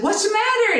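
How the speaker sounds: high-pitched alarmed mother; retold urgent demand from a mother; grizzly bear mama. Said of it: "grizzly bear mama comes out".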